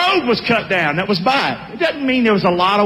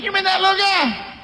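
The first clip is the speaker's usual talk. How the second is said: scoffing; 9 semitones higher than usual. Acted. Townsman scoffing at Gideon.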